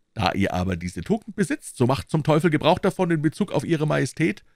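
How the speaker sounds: a big friend setting him straight; lecturing Porthos. A narrator's reading. Porthos scolds Aramis before the musketeers, lecturing.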